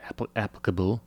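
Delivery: "applicable" with an English tinge; English pronunciation. Real, not acted. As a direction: let a bit of Mum's English into "applicable". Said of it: "Your mother's English is coming out".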